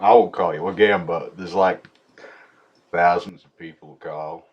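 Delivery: ordinary talk, nothing marked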